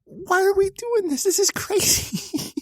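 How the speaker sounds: high, slow disbelieving whine; exasperated, can't believe it; incredulous. Real, not acted. Laughing disbelief at the story.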